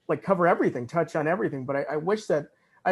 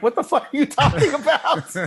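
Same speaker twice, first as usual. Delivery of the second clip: laughing through a quote; 11 semitones higher, 6 dB louder than usual. Retold or imitated. Leonard reacting, speaker laughing.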